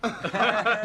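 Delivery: loud jeering chuckle; mocking laugh. Real, not acted. Reporter jeering at Molly's story, mocking laugh. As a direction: laugh at her to mock.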